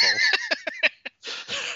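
shrieking giggle; high squealing laugh. Real, not acted. Laughs at own gravel joke.

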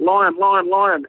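retold scream. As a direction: call out a repeated warning, re-enacting panic without full force. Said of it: "I started screaming out".

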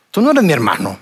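low, flat menacing retort; cold hostile reply. Retold or imitated. Local man rejecting the visitor, a hint of low menace. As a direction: shut him down coldly. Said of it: "me dijo".

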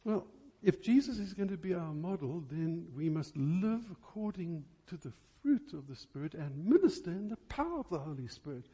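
normal talking voice

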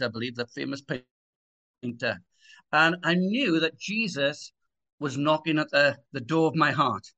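ordinary talk

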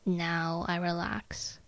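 slow, soft, sleepy self-talk; drowsy voice. Shown as a demonstration. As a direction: let each phrase sink, drowsy and slow. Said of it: "use your most comfortable, tired, drowsy voice".

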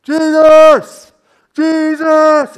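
high, very loud long-drawn shout; full-volume, high-pitched cry for attention. Acted. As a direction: bellow the name over a noisy crowd. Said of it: "there is somebody shouting".

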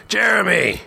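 high, drawn-out bellow; very high shouted name. A narrator's reading. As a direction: bellow the name right in his face.

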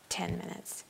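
"ten minutes" repeated in a breathy whisper; whispered echo. Shown as a demonstration.

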